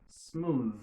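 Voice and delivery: "Smooth" slowly finished on a buzzing th; voiced final th. Shown as a demonstration. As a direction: model "Smooth" with a humming th. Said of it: "On répète ça". ("smooth" ak th sonò)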